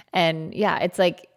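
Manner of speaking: normal talking voice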